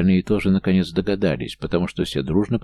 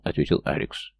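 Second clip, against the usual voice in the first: usual speaking voice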